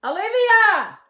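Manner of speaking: loud high call across the house; shouting a name down the hall. Real, not acted. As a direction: call her name from far away. Calling daughter again.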